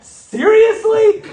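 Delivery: high, loud, drawn-out disbelief; incredulous. Retold or imitated. Husband mocks his own outburst at his wife, loud, high and drawn-out. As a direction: stretch it out in mock outrage. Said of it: "I'm like".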